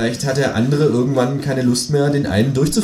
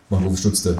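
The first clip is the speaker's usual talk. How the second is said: ordinary delivery, nothing marked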